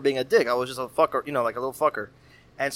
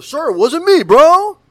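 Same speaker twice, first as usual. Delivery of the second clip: put-on high, loud, whiny kid protesting; 13 semitones higher, 13 dB louder, slower than usual. Mauricio pleading innocence to the teacher, high loud whine. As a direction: cry out innocent like a wronged teen. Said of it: "And that kid Mauricio".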